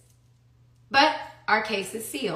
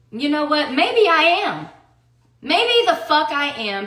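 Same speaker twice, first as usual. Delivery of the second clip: louder, higher defiant retort; real defiance, raised voice; 5 semitones higher, 5 dB louder than usual. Real, not acted. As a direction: throw it back hard, pitched up. Defiant reply to being called bitter.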